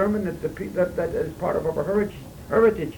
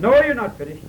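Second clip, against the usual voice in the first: higher, louder "No, you're not finished" insisting; the friend pushing back hard; insistent friend; 7 semitones higher, 7 dB louder than usual. Retold or imitated. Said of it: "so echoed and so rang in my ears".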